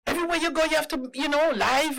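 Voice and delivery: high, quick carping imitation; needling put-on voice; mocking critics. Mimicking critics of her livestreams.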